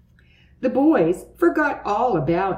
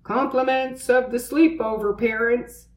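high put-on delivery voice; unfamiliar voice calling; 5 semitones higher than usual. A narrator's reading. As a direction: announce it like a delivery man. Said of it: "called an unfamiliar voice".

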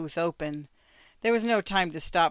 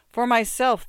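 raised, louder plea; begging; 6 semitones higher, 8 dB louder than usual. A narrator's reading. Old father pleading for his boy, begging. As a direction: plead with some urgency. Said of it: "the old man begged".

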